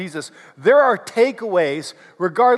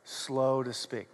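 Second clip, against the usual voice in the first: softer, lower, slowed; slow and quiet; 7 semitones lower, 12 dB quieter, slower than usual. Shown as a demonstration. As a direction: drawl it softly and low. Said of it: "say it with me and do the hand motions".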